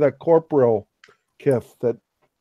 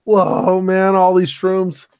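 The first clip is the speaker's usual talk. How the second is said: freaked-out tripper; 6 semitones higher, slower than usual. Acted. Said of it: "like, a super crazy, like, freak out".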